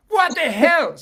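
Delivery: loud, high disbelieving cry; exasperated outburst. Real, not acted. To the group, exclaiming loudly in disbelief. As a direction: blurt it out, fed up.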